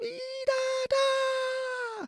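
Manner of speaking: very high, shrill calling cry. A narrator's reading. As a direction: shriek it from far away with joy. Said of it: "eine schrille Stimme schreien".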